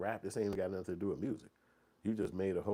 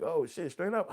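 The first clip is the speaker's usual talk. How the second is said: pleased surprise; 10 semitones higher, 5 dB louder than usual. Retold or imitated. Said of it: "I'm like".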